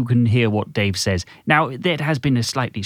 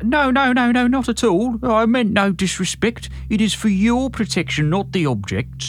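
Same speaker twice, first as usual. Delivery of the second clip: put-on high, slow, anxious old-man protest; alarmed stutter; 9 semitones higher, slower than usual. A narrator's reading. Wizened man placating the angry boss, stuttering in alarm. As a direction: backpedal in a high, quavering plea. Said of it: "He stuttered".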